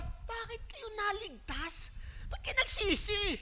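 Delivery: high, slow, whiny Jonah voice; mock angry Jonah. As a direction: act out Jonah sulking that they were saved. Preacher voicing angry Jonah. (ginagaya si Jonah na nagtatampo)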